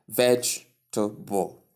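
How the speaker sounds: vegetable in three syllables, VEJ-tuh-bul; correct pronunciation. Shown as a demonstration. Teacher models the word vegetable for learners, correct three-syllable pronunciation. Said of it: "that's the right pronunciation".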